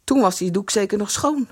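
usual speaking voice

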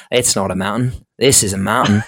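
hammed-up bad Aussie movie quote; Crocodile Dundee. Friend parroting the mountain joke back, pathetic Australian accent. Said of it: "how pathetic my Australian accent was".

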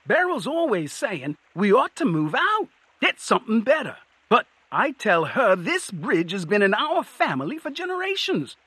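high-pitched, happy troll chatter; proud troll. A narrator's reading. Troll telling Cohen of its family bridge, high and proud. Troll figure speaks in story.

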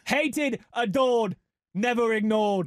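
loud, high Yinzer slogan chant; Pittsburgh accent. Acted. Host chants the slogan for his co-host in a Pittsburgh accent. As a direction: proclaim it with Pittsburgh pride in the accent. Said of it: "Just bring the accent with it".

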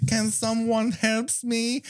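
high, slow, hammed-up French accent; mock French maid plea. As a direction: beg for help like a campy movie maid. Continuing the French maid parody.

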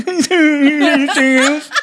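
very high, very slow sing-song copy; mocking waitress. Husband mocking the server's question, high and drawn out. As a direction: ham up the server's line. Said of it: "I'm like".

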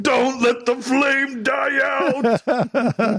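slow, high, drawn-out cartoon shout; hammed-up Plankton plea; Plankton meme voice. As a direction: stretch every word like a desperate cartoon villain. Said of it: "the Plankton meme where he's like".

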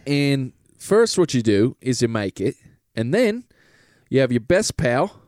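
hammed-up British pitch to the lads; British salesman. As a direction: sell it like a cheeky British huckster. Plays a British Goop salesman.

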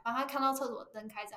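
ordinary talk

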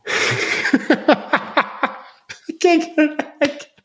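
high, squeaky words through real laughter; laughing. Real, not acted. Host cracking up at his own crime joke, high and laughing. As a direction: laugh your way through the line. Joking about a terrible crime.

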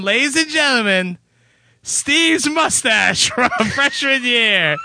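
high, drawn-out showman introduction; hammed-up fanfare for a surprise guest; mock announcer. Host introducing a mustache as special guest, mock announcer.

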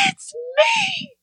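high, crying shout of joy; crying scream. Retold or imitated. Woman running to the pulpit, crying shout. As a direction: shout it out through tears. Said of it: "I'm screaming".

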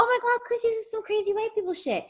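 high, excited mock gush. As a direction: gush like you just found juicy gossip. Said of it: "And I was like".

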